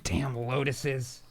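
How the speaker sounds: ordinary delivery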